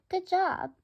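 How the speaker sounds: high, bright praise for a pet; happy praise. Real, not acted. Narrator praises Mango for taking the millet, high and pleased. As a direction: reward the bird with your voice.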